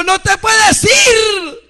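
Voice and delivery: slow, high, loud stretched command; bishop's command. Retold or imitated. Bishop ordering the narrator to stay, slow high shout. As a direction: hold him back with a slow loud cry. Bishop stops him leaving body.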